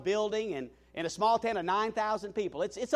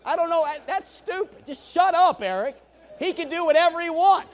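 higher and louder, berating himself; loud self-scolding; 9 semitones higher, 9 dB louder than usual. Real, not acted. Preacher scolds himself for his rude remark, loud. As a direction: yell at yourself in disbelief.

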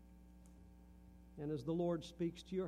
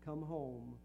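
reciting a hymn chorus; 3 dB louder than usual. Acted. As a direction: plead the chorus, then let it fade away. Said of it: "the chorus says".

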